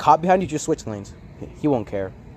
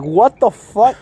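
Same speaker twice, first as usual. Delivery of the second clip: retold shock; 7 semitones higher, 5 dB louder, much slower than usual. Retold shock at fallen bumper.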